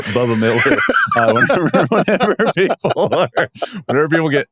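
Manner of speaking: cracking up mid-sentence; laughing. Real, not acted. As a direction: laugh through the name Bubba. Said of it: "you're in my head now".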